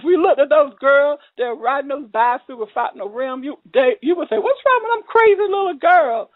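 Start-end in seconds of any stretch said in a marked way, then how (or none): 4.4-6.3 high-pitched mock disbelief; astonished onlooker. Onlookers remarking on girls riding tireless bikes, mock astonished. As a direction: imitate a bystander exclaiming, pitched way up.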